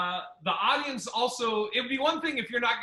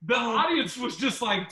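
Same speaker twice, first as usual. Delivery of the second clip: usual speaking voice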